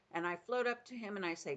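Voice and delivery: ordinary delivery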